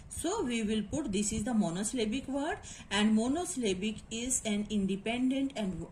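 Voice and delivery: ordinary talk, nothing marked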